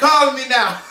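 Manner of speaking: high, loud mock Jamaican accent; a laughing send-up of a friend's Jamaican lilt; Jamaican accent impression. Asked by a co-host, mocking a friend's Jamaican accent. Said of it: "do an impression of what you think Monet sounds like".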